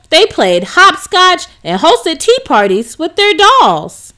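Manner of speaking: plain reading voice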